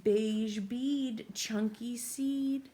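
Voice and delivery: put-on slow, sing-song rhyme for a child; riddle read-aloud. A narrator's reading. Teacher reads the rhyme to a young child, slow sing-song. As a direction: chant the riddle slowly to a little listener.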